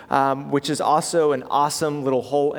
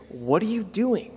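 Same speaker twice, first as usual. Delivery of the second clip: rebuking; 5 semitones higher, 3 dB quieter than usual. Retold or imitated. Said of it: "subtext, they are indirectly kind of rebuking Jesus".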